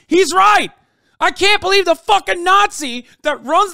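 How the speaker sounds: sudden high, loud outburst of disbelief; outraged shout. Real, not acted. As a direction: blurt it out at full volume, stunned. Streamer shocked by read quote.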